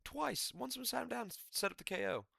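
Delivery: high, amazed exclaiming. Real, not acted. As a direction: marvel at it.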